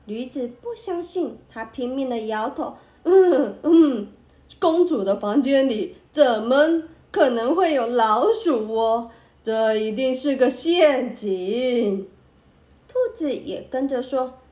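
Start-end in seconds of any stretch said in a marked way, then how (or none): 3.0-12.1 donkey prince refusing loudly; stubborn donkey voice. A narrator's reading. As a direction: protest like an indignant donkey. Donkey protesting, shaking head.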